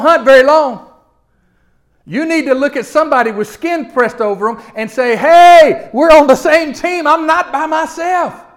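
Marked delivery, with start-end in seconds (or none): 5.2-8.4 calling out loud. Retold or imitated. Calling to a fellow believer.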